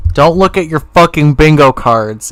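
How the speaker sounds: nearly shouting the warning; loud exasperated. Real, not acted. Repeats warning loudly.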